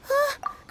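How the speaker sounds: quick surprised gasp; sharp little intake of breath. Acted. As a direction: catch your breath in surprise. Sudden realization.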